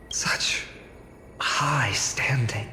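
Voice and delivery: slow, breathless hush; awed whisper. Acted. Fairy whispers in awe at the noble weaver.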